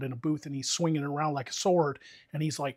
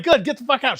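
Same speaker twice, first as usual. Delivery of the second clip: high, loud, laughing kiss-off; gleeful telling off; 9 semitones higher, 7 dB louder than usual. Retold or imitated. Worker telling the yelling customer to leave, high and gleeful. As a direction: send her packing with glee.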